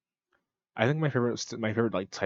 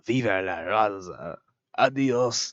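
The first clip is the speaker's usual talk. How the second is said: Eddie Guerrero; slower than usual. Acted. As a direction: put on a Spanish wrestler's catchphrase voice. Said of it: "It's just Eddie Guerrero".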